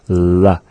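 La with the tongue tip up; plain L sound. Shown as a demonstration. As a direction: hold the L in La. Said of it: "place the tongue to make that sound".

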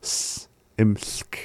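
"S-, imsk" with a lone hissed s, then imsk; separated S sound. Shown as a demonstration. Teacher shows there's already an S, sounded separately. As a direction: say "S-, imsk", splitting the s off softly. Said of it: "there's already S there".

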